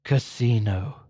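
low, solemn one-word command; voice of God. Acted. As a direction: intone it low like a heavenly voice. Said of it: "he hears the voice".

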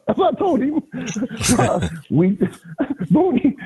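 high laugh breaking in; giggling through it. Real, not acted. Laughing at his own story.

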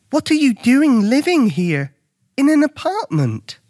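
put-on high, incredulous boy's voice; curious young Luke. A narrator's reading. Luke questions the elephant about the apartment, incredulous. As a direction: ask in high-pitched bewilderment.

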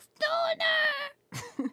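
put-on high, loud old-lady cry; little grandma yelling. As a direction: shriek it like a startled grandma. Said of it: "little grandma comes out of nowhere, and she's like".

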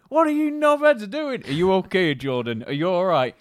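put-on loud, high angry dad yelling at the boys; Joel's dad shouting. Friend's dad shouting at the teenage boys, loud and high. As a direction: bellow like a fed-up dad. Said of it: "he was like".

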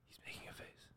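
giggly prank whisper. Real, not acted. Hushed while friend on phone.

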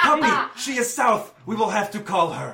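high, put-on voice, urgent and pitiful; pleading injured man. Injured man begs help for his puppy, high pleading. Plays injured dog owner.

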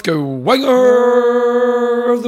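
loud, high, drawn-out announcer call; segment announcer. Acted. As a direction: belt it out like a show segment intro.

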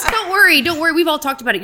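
high, loud imitation of a reassuring sister; hammed-up reassurance, pitched well up; teasing sister's reassurance. Retelling sister's reply to a tired speaker, exaggerated reassurance.

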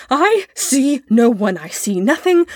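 understated frightened scream, starting high; mild scream. A narrator's reading. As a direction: cry it out in restrained panic. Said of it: "Mister Bonds screamed".

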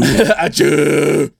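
laughing fake sneeze; sneezing. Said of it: "it's like a demonic sneeze".

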